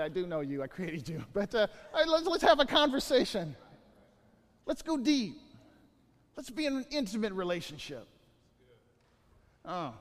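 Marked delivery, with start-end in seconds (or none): 1.9-8.1 eager invitation. Retold or imitated. Voicing God's invitation.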